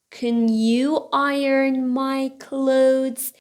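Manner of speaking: slow, drawn-out learner's model; slow model reading. Shown as a demonstration. Teacher repeats the books sentence for learners, slowly. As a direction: give each word room, slowly.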